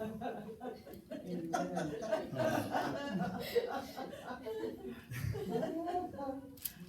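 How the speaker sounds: long high laughter; real laugh. Real, not acted. Congregation joke, long high laugh. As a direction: let the laugh roll on.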